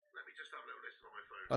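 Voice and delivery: fast, soft whispered repeat. Real, not acted. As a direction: whisper it to yourself, quickly. Says it, then whispers it.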